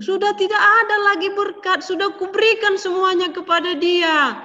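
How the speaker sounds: high, loud lament; sorrowful old father. A narrator's reading. Father tells Esau no blessing is left, high and sorrowful. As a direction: tell him it's all given away.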